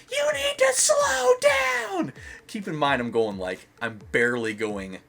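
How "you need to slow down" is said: high, slow screeching mock shout; hammed-up scolding scream, drawn out; imitated scream. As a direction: screech it like an outraged stranger. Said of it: "she just screams at me".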